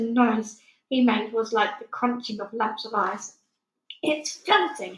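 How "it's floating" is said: spluttering through a mouthful; spluttered. A narrator's reading. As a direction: talk round a bite of crunchy food. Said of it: "he spluttered".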